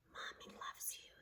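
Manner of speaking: soft unvoiced whisper of a mother's words. A narrator's reading. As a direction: breathe it like a remembered voice. Mother's words heard in head.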